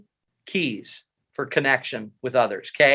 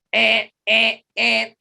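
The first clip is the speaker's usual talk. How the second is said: alarm sound imitation; 7 semitones higher, 4 dB louder, slower than usual. Fight or flight alarm.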